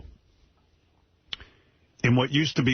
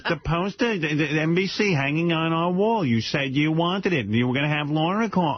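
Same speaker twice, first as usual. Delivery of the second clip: imitating his mother; 7 semitones higher than usual. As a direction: insist like an exasperated mother. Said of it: "She goes".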